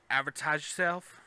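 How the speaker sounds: ordinary delivery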